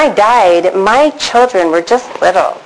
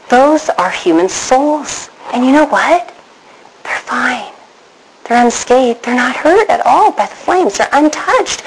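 awed relief; 4 semitones higher than usual. Retold or imitated. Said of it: "I'm like".

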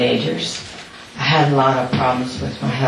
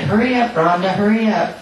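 higher calling voice to the daughter; 6 semitones higher, faster than usual. Retold or imitated. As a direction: call her to hurry, a mild retold call.

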